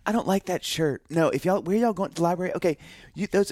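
imitating his overeager self; eager chatter. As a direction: try too hard to be charming. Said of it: "I'm still trying to".

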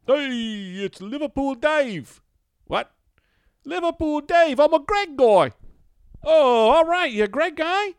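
put-on loud, very high Liverpool accent caller; high, loud Liverpool accent announcing himself; Liverpool Dave. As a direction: yell it out like a rowdy Scouse bloke on the phone. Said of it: "this accent keeps coming back".

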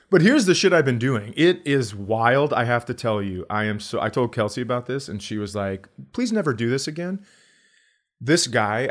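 normal talking voice

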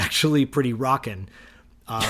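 ordinary talk

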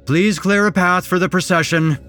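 constable calling out. A narrator's reading. Constable clears a path through the crowd, shouted order. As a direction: call over a crowd to make way.

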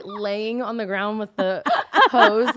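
ordinary delivery

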